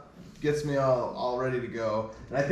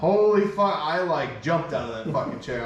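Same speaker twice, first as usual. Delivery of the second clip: usual speaking voice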